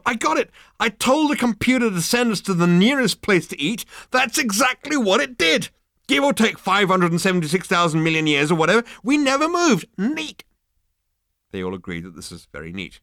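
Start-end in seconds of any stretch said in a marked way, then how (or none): 0.0-10.4 very high, loud outburst; triumphant excitement. Acted. Zaphod explains how they never moved, exultant. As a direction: crow with delight at the solution.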